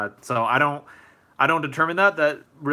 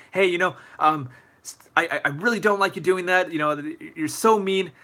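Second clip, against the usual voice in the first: higher-pitched mock accusation; angry accusing; 5 semitones higher than usual. Bullied kid accusing the bully, mock angry. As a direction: pretend to get heated and accuse them. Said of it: "start being angry at them and you start accusing them".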